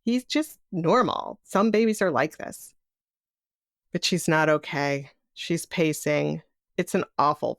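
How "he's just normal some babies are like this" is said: high-pitched protest that all is well; retold parents insisting, higher voice; defensive reassurance. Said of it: "we defend our newborn baby Ben".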